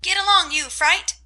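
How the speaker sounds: high, loud parrot scream. A narrator's reading. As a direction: screech it rudely like a parrot. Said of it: "screamed Polly".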